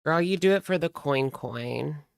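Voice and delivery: ordinary talk, nothing marked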